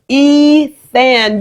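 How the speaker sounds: "Ethan" loud and high, EE stretched; sounded-out discovery. Retold or imitated. Pupils comparing words with and without vowels, sounded-out "Ethan". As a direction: imitate a pupil sounding out "Ethan" in two parts. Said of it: "compare the word without the vowels and go".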